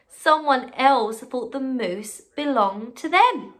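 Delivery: slow, dramatic read-aloud; slow it down to build suspense; slow story reading. A narrator's reading. Teacher reading a picture book to her class, slow and dramatic.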